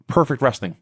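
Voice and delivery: usual speaking voice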